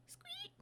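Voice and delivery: tiny high squeak; mouse squeak. Acted. The mouse Peanut, tiny frightened squeak.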